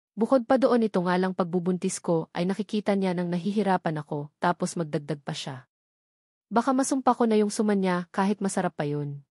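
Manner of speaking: ordinary delivery, nothing marked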